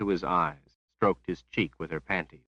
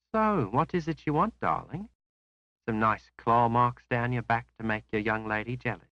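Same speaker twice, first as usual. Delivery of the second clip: higher, sweet voice with a thread of sarcasm; a young courtesan teasing her client; Rose, sly and breathy; 5 semitones higher than usual. A narrator's reading. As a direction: purr it, sarcasm hidden under sweetness.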